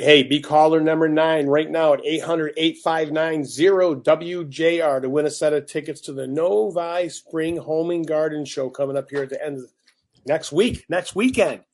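upbeat on-air contest read; radio contest promo. Acted. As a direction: pitch the giveaway like a DJ. Reads contest call-in promo.

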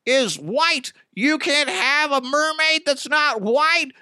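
high, whiny mock outrage; mock freak-out. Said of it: "All the racist people are freaking out".

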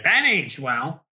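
drawn-out jeer of an online troll; mocking troll. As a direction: lightly imitate a gloating troll. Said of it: "the trolls will be like".

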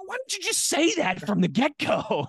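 high, comic disbelief at the reveal; mock exasperation. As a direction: squeak it out like a friend who was kept in the dark.

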